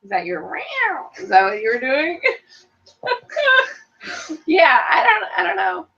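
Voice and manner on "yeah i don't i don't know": mock cry. Mock crying reply.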